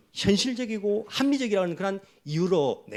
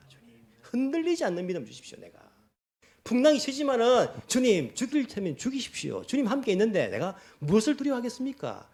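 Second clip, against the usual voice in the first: earnest pleading prayer to the Lord; a believer praying in the storm, fervent prayer; 5 semitones higher than usual. Acted. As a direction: pray it with devoted trust. Voices a prayer of trust.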